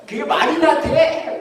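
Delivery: high, slow mock shout; drawn-out drunken protest, played for laughs; indignant drunkard. Drunk objects outside the church, mock-indignant shout. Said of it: "막 이러-, 이러는 거예요".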